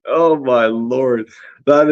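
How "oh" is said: ordinary delivery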